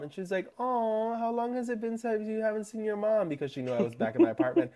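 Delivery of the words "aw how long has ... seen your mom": high-pitched cooing sympathy; imitating a friend's pitying "aw"; sympathetic friend. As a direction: coo it like a concerned friend, pitched up. Friend sympathizing in retold chat.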